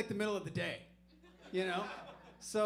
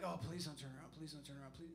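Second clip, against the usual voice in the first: hushed pleading; 4 semitones lower, 7 dB quieter, faster than usual. Retold or imitated. Praying the cop won't turn.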